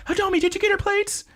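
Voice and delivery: squeaky high-pitched eager mom voice; excited mom. Retold or imitated. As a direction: chirp it up high like an excited mother. Mom voiced in show recap.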